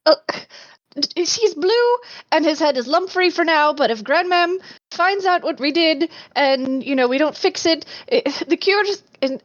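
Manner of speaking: high, fast, breathless apology; deferential apologetic girl. Acted. Changeling sister apologizing to the stump creature, high and flustered. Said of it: "she says".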